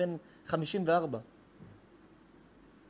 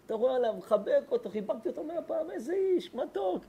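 much higher, squeaky gush; 10 semitones higher than usual. Acted. Rabbi gushing to listeners about the sweet father, high squeaky coo. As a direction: coo it like you melt over him.

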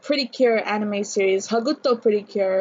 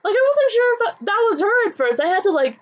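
gush, still buzzing from the news; excited gush; 9 semitones higher than usual. Real, not acted. Fan telling viewers about the casting, high and excited. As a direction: talk on a raised, thrilled pitch. Still thrilled after the squeal.

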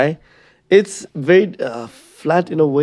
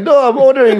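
mock protest; 12 semitones higher, 7 dB louder than usual. Customer protesting in skit.

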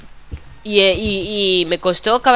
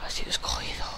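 whispered translation; 13 dB quieter, faster than usual. Real, not acted.

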